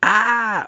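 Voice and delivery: high, loud "aah" scream; sudden scream. Acted. Guard seized by a huge claw at the window, sudden scream. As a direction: cry out sharply as if grabbed.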